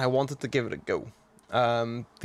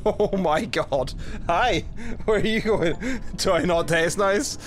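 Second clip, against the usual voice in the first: laughing, higher-pitched disbelief; amused shock; 5 semitones higher, slower than usual. Real, not acted. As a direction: call out through startled laughter. Just eaten by creature, laughing, calling it.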